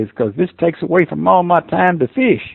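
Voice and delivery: retold kid's plea, pitched well up; boyish protest. Boy telling coach why he won't play baseball, high-pitched protest. Retelling his boyhood refusal to coach.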